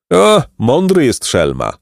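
storyteller's hint of a laughing old man; amused old man. A narrator's reading. Old man admires the crafty moon, mildly amused exclamation. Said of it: "zaśmiał się staruszek".

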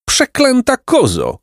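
slow, high shout at the goat; angry shout. Acted. Placek knocked down, shouting at the goat in slow high anger. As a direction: curse the goat, pitching way up. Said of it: "krzyknął, powstając".

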